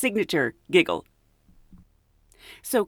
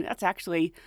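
ordinary delivery

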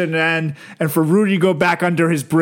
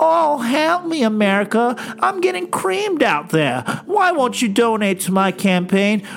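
much higher, mock-whining plea; whiny Lindsey Graham; 5 semitones higher than usual. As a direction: ham up a desperate complainer.

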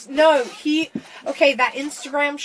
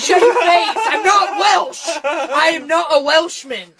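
loud, higher, laughing protest; mock outrage; 5 semitones higher, 6 dB louder than usual. Teased about the accent being Welsh, mock-outraged. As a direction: shout it in playful indignation.

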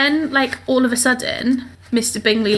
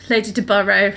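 ordinary talk, nothing marked

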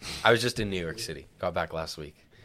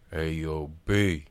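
New York street voice; 2 semitones lower, much slower than usual. Retold or imitated. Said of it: "he's like".

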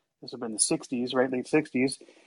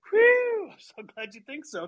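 high, breathy burst of relief; relieved sigh; 11 semitones higher, 4 dB louder than usual. Retold or imitated. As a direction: let out a big high whew of relief. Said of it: "I was like".

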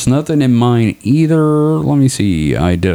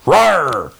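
mock roar; 9 semitones higher than usual. Voicing whale picture again.

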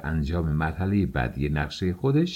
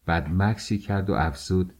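plain reading voice

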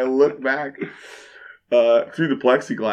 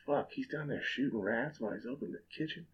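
much softer, an inner voice of disbelief; muttered disbelief; 17 dB quieter than usual. Retold or imitated. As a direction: say it under your breath, stunned. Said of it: "I'm just like".

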